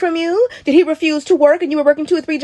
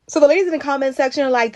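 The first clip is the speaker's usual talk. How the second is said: normal talking voice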